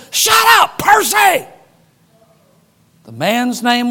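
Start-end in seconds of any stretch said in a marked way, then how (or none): 0.1-1.4 high loud yell at the dog; big man yelling at his dog; shouting at the dog. Retold or imitated. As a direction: bark the order at the dog. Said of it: "And then he says".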